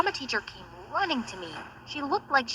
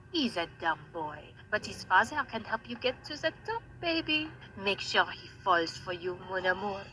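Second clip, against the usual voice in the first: softer conspiring voice; scheming hush; 4 semitones lower, 9 dB quieter than usual. Acted. Mom plotting with Natasha, soft conspiring voice. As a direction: murmur the plan to your daughter.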